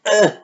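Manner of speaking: short, loud groan of disgust; disgusted ugh. Real, not acted. Vlogger reacting to trash guys in novels, disgusted groan.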